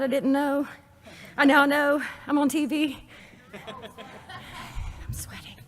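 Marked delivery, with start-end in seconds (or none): 3.5-5.6 slow laughing whisper. Real, not acted. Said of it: "You can still hear me when I whisper".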